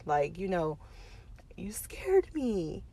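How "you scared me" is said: slow, soft whisper. Retold or imitated. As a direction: breathe it out slowly, still shaken. Mother retelling what she told daughter.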